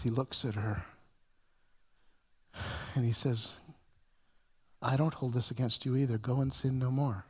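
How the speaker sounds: low, soft, tender words of forgiveness; a hushed, tender release; gentle. Retold or imitated. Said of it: "gently, out of goodness".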